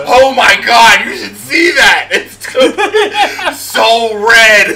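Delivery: loud, slow, high-pitched mock horror; drawn-out shouting through laughter; hammed-up shock. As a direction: yell it in gleeful fake horror. Hammed-up reaction to offscreen gory slicing.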